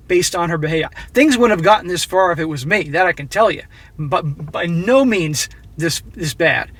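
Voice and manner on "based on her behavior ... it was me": ordinary talk, nothing marked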